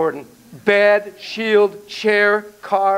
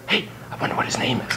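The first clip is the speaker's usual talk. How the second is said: quick hushed whisper; 5 semitones lower, 7 dB quieter, faster than usual. Shown as a demonstration. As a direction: whisper it fast, off to the side. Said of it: "I go".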